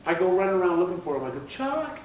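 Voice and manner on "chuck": high-pitched retold call; searching shout, pitched way up. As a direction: call for a friend across backstage. Retold calling out for friend.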